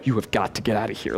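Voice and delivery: low, softened warning; wife's stern warning. Retold or imitated. Wife warning her husband to leave, stern and low. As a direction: warn him off under your breath. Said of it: "because they're like".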